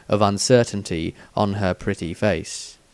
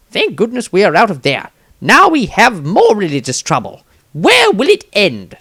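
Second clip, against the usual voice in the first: ordinary delivery, nothing marked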